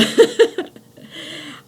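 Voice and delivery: airy high giggle; breathy laugh. Real, not acted. Laughs, unvoiced.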